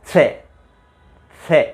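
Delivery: स said as retroflex ष, tongue tip curled back; retroflex sha demo. Shown as a demonstration. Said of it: "फिर बोलो". (मूर्धन्य ष का उच्चारण)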